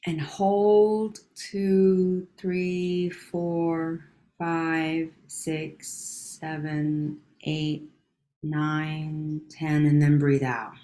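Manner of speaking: slow, hushed counting, trailing into whispers; guided breathing count. Acted.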